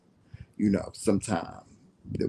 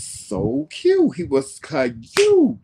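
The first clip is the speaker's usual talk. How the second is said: high drawn-out squeal on cute; gushing; 12 semitones higher, 8 dB louder, much slower than usual. Real, not acted. Telling friends about a cute little boy, gushing. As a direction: gush loudly, stretching out the word.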